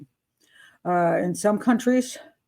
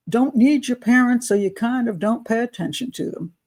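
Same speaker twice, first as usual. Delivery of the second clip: ordinary delivery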